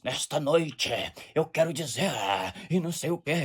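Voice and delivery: lightly voiced old-school pentecostal preacher; a hint of a solemn pentecostal preacher; Napoleão Falcão impression. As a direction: imitate a preacher from the old days. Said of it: "eles imitavam muito Napoleão Falcão".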